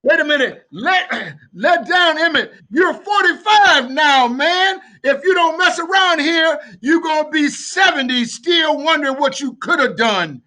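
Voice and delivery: loud, high, building sermon; fiery preaching. Real, not acted. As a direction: preach it with rising fire. Preaching at full force.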